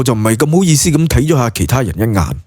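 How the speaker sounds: normal talking voice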